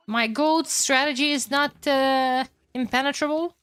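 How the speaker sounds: usual speaking voice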